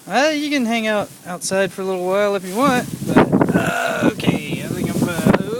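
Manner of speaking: loud, fast, breathless talk then laughter; laughing. Real, not acted. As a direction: laugh through the storm. Half-shouting in storm, laughing.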